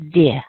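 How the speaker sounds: "di" with a clear short i as in kiss; short i variant. Shown as a demonstration. Teacher showing one way to say the first syllable, clearly. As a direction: say "di" with the i of fish. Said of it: "You can say".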